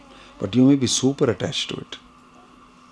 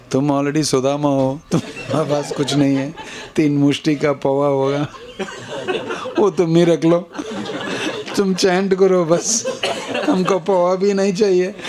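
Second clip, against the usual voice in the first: fast, higher, chuckling through the words; laughing playfully; 7 semitones higher, much faster than usual. Acted. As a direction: tease them with a laugh, quickly. Playfully voicing Krishna to listeners.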